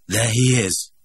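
brisk, pointed announcement of the man; single him out to the room; Bernard's voice. A narrator's reading. Bernard pointing out the director to the crowd, announcing. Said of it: "he said".

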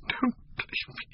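the final plea breathed as a voiceless whisper, choked with sobbing; broken whisper. A narrator's reading. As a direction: let "Don't leave me." collapse into a whispered sob. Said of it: "A fit of passionate sobbing choked her".

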